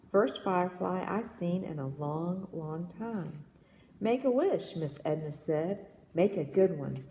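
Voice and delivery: measured, lilting poetry read-aloud; gentle storytelling poem voice. Acted.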